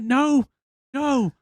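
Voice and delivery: petulant high-pitched no no; mock outraged no. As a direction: throw out a stroppy no like a sulking Mario. Mario refusing in acted quote.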